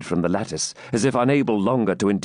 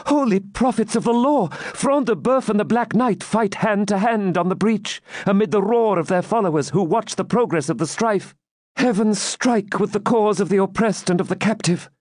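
high-pitched, agitated maiden; fervent exclamation; 11 semitones higher than usual. A narrator's reading. Maiden watches champions fight hand to hand, fervent. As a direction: cry it out high like an awed eyewitness. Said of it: "almost immediately exclaimed".

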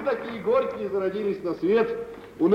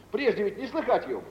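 ordinary talk

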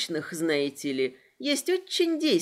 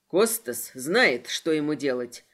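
understated bark; 6 semitones lower than usual. A narrator's reading. Trenton to heroine in the carriage, understated bark. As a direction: growl it low rather than shout. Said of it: "рявкнул Трентон".

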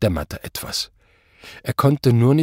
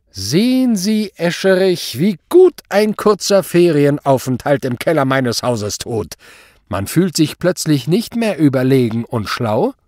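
gloating taunt; 5 semitones higher, 5 dB louder than usual. A narrator's reading. Superior taunts commissioner about the cellar, gloating. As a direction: savour his humiliation.

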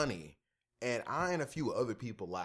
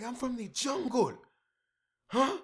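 high, louder offended outburst; mock indignant comedian; 11 semitones higher, 4 dB louder than usual. Friend mimicking the comedian getting pissed off, high mock outrage. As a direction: snap it back, offended and high.

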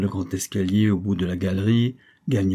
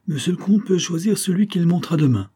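higher-pitched, mildly raised announcement; calling out; 8 semitones higher, 3 dB louder than usual. A narrator's reading. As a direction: call it out high, without a full shout. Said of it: "se fit entendre de nouveau et cria".

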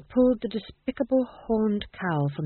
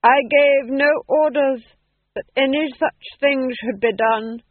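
forced calm; 6 semitones higher, 7 dB louder, slower than usual. Acted. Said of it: "said the Duke calmly".

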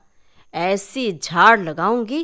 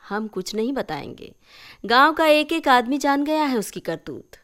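scornful aunt; 3 semitones higher than usual. Acted. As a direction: scoff at the boy. (चाची की ऊँची, तिरस्कार भरी आवाज़)

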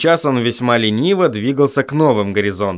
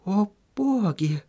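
much softer, slow dazed mumble; 7 semitones higher, 8 dB quieter, slower than usual. A narrator's reading. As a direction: mutter it, coming round. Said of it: "пробормотала Сахариса".

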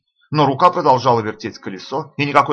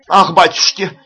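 high, loud startled gasp; frightened cry; 6 semitones higher, 6 dB louder, slower than usual. A narrator's reading. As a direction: cry out in alarm. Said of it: "опять вскрикнула Тарасовна". (испуганно вскрикнув)

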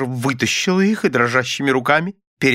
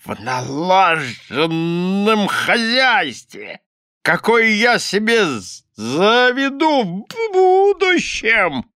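high, drawn-out, blustering declamation; pompous tirade; 8 semitones higher, slower than usual. A narrator's reading. Husband lecturing his wife on housekeeping, pompously indignant. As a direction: lay down the law, stretching the words high.

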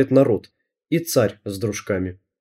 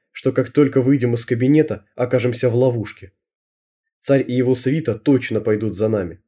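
ordinary delivery, nothing marked

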